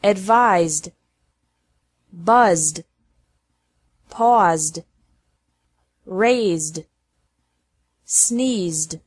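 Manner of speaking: voiced d ending, no extra syllable. Shown as a demonstration. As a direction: model word list. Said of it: "pronounced like D".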